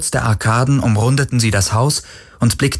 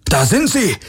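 much higher shout in another voice; guard calling out triumphantly; called out; 10 semitones higher than usual. A narrator's reading. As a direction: yell the discovery to the others. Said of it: "rief einer von ihnen".

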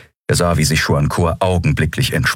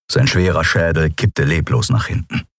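usual speaking voice

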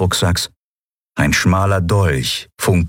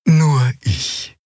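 put-on high gentle old creature voice; tender, high-pitched elderly healer; 9 semitones higher than usual. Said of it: "Sanft erklärte sie Lien".

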